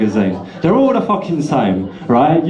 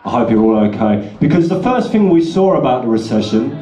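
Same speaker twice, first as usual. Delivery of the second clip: ordinary delivery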